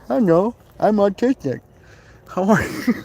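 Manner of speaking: hammed-up fake voice.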